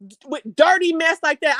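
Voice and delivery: usual speaking voice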